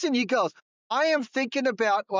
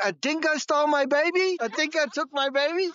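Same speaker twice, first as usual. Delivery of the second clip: a higher-pitched attempt at an Australian accent, a bit hammed-up; mock Australian accent; 8 semitones higher than usual. Shown as a demonstration. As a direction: try the Australian accent on a famous line. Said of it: "In an Australian accent".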